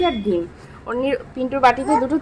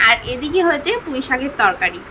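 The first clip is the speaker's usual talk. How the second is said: normal talking voice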